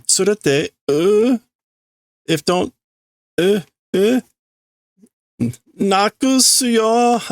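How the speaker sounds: put-on high childish voice breaking into whimpers; little girl welling up, high and slow; Nel about to cry. Little girl threatening to cry, high whiny voice. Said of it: "That's her crying".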